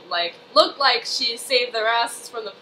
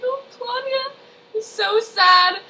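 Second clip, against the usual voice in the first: tearful fan reaction; 12 semitones higher, slower than usual. Retold or imitated. Fan reacting to a sad scene.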